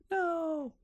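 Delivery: high, soft drawn-out wail of dread; hammed-up panicked "No", held long and high; small, high mock-panicked cry. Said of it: "you have a full-scale panic".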